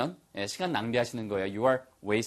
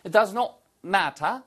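British "matter" with crisp t and no r, higher pitch; British accent; 5 semitones higher, much slower than usual. Shown as a demonstration. Teacher demonstrates for students, British accent. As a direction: put on British pronunciation for "matter". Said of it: "이것도 역시 똑같이 영국식 발음이잖아요".

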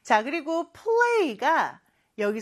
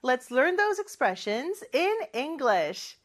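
slow English read-out; much slower than usual. Shown as a demonstration. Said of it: "teacher reads English slowly".

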